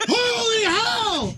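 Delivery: slow laughing shout; real stunned yell. Real, not acted. Reacting to smelling salts.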